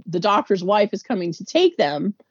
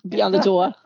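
put-on Long Island accent; 4 semitones lower than usual. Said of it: "my Long Island accent".

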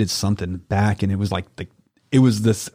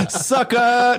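mock taunt; 12 semitones higher than usual. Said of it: "No, I'm just kidding".

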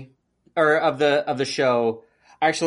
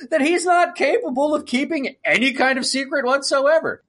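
high, laughing disbelief; incredulous rant; 11 semitones higher, 3 dB louder than usual. Retold or imitated. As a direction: ask it high, in exasperated disbelief. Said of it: "I'm like".

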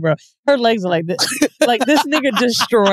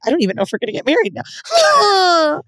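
higher-pitched mock sobbing wail; mock crying; 6 semitones higher than usual. Crying partner frets about the engagement, mock tearful. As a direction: imitate her crying through the words. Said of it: "this bitch is crying. She's like".

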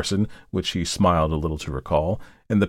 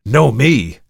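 loud, raised-pitch exclamation; indignant cry; 4 semitones higher, 9 dB louder than usual. A narrator's reading. Markheim throws the visitor's claim back, indignant cry. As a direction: cry it out in disbelief. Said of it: "cried Markheim".

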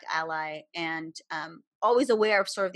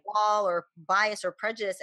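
normal talking voice

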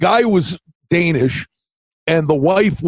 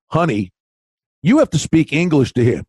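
gentle mother; 3 semitones lower than usual. Retold or imitated. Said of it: "The mother looks at the three-year-old kid and goes".

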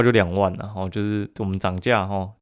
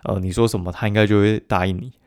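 ordinary talk, nothing marked

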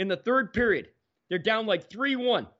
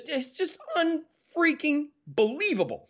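much higher, slow, stretched-out disbelief; amazed exclamation; 12 semitones higher than usual. Real, not acted. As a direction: marvel at it, drawing it out high. Amazed at the memorabilia.

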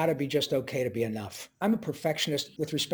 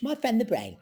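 the Brain voice; 8 semitones higher than usual. Acted. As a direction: drop into the Brain's deliberate tone. Voicing the Brain character.

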